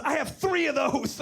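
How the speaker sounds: excited boast. Retold or imitated. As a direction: shout it proudly. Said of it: "he goes".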